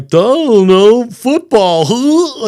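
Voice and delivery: high, loud, slow goofy dork; dopey nerd, drawn out. Retold or imitated. Host parodies morning zoo dork, loud goofy pitch. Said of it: "you've got the dork that's like".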